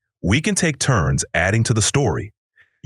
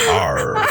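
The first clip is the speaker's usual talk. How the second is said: pirate growl. Shown as a demonstration. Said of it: "Do a pirate".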